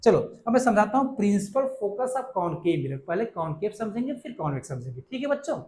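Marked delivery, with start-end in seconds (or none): none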